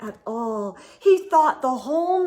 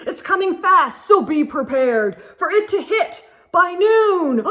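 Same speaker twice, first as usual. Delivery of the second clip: urgent, louder bulletin voice; news announcer; 4 semitones higher, 5 dB louder than usual. Acted. Announcer warning the town of the fireball, urgent. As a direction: announce the emergency with alarm. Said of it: "the news announcer said".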